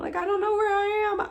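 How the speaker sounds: high, fast, acted-out distress; retold alarm, voice shooting up; panicked. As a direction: blurt it in frantic confusion.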